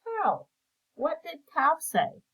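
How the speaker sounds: usual speaking voice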